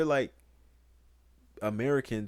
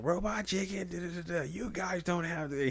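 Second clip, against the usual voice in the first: higher, hammed-up cartoon teen rant; mock angry Chris; 11 semitones higher than usual. Retelling the Family Guy bit, mock Chris rant. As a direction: do Chris storming off in a huff. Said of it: "He was like".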